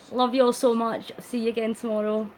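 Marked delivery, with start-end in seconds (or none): none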